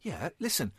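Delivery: unvoiced whispered aside; whispering. Real, not acted. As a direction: hush them with a whisper. Hushes co-host.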